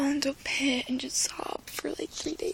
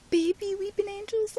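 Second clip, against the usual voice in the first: higher and louder incredulous question; excited disbelief; 6 semitones higher, 5 dB louder than usual. Real, not acted. Fan reacting to the trailer, excited disbelief. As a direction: squeal it in disbelief.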